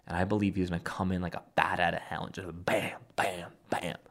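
hushed, eager whisper building to bam; soft conspiratorial hype, punching each bam; excited whisper. Real, not acted. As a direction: whisper it like a secret thrill. Hyped prediction of Trump's return.

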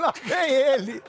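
slow, stretched mock announcement with a cry; drawn-out announcer call. Host calling the old guest back to the mic, stretched and slow. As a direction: call him in like a stadium announcer.

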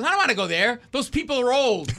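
indignant protest. Retold or imitated. Elderly mom refusing the senior center, indignant protest. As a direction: balk loudly as an affronted mother.

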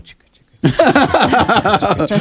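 imagined train chant. Retold or imitated. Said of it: "in my mind going".

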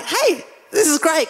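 high-pitched, eager mock announcement; retold enthusiasm. Wife telling husband about Gnomesville, mock excited. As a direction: act out bringing someone great news.

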